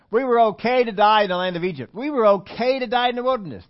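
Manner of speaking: loud, high, emphatic mock declaration; mock protest. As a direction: overplay the complainers' bold claim. Mimics the complainers' tacked-on claim.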